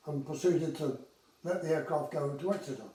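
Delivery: normal talking voice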